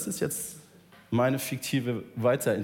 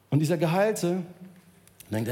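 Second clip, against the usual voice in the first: ordinary talk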